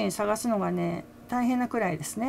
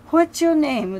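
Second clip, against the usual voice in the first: higher, louder mock stage question; retold call-out; 7 semitones higher, 9 dB louder, much slower than usual. Singer asks the audience their names, retold as a raised call-out. Retelling singer asking the crowd.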